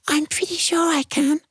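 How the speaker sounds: a tiny ant calling from the rose bush. Acted. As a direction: miniature squeak. Ant answers.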